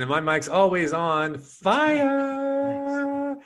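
drawn-out, soaring high finish; hyped-up self-introduction; showy announcer flourish. Pretend. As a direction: stretch the last word way up high.

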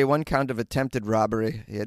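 ordinary delivery

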